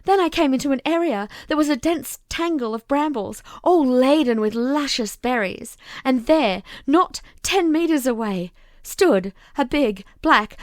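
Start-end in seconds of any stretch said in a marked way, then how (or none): none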